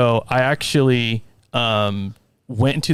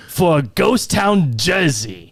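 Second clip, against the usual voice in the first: slow, drawn-out character pronouncement; mimicking John; 5 semitones higher, much slower than usual. Scare actor ticketing a man for his jersey, mimicked character voice. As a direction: announce the offence in mock Irish character. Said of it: "I'm trying to mimic his voice".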